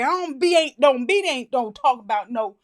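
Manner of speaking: loud, choppy caricature; mock garbled dialect. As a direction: jumble the words in exaggerated mock dialect. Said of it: "We don't like".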